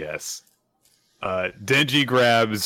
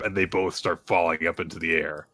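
ordinary delivery

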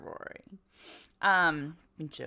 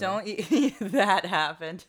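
laughing; 6 dB louder, much slower than usual. Real, not acted. Laughing while repeating.

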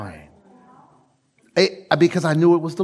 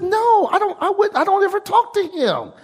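much higher voiced wife's reply; imitating wife. Wife answering the preacher, in a higher imitated voice. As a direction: lift up into the wife's voice.

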